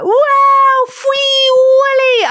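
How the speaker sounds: put-on high, slow, gleeful little boy squeal; high drawn-out child's cheer; kid's gleeful yell. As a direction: squeal it like a delighted toddler. Toddler shouting on slide.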